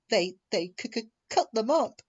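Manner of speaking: stammering, voice rising high near tears; mock near-sob, catching on the words; nearly sobbing. Percy telling of engines cut up, nearly sobbing. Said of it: "Percy nearly sobbed".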